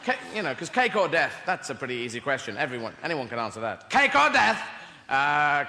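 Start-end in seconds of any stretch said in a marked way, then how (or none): 3.9-4.6 loud, high menacing demand; menacing inquisitor. Retold or imitated. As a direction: bark it like an inquisitor. Anglican inquisitor demands choice.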